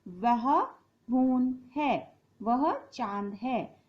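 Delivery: वह चांद है said slowly, pointing far; slow, clear demo of वह चांद है; slow pointing phrase. Shown as a demonstration. Teacher demos pointing at the far moon, slowly. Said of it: "ऐसे ही दिखाएंगे ना हम दूर की चीज को".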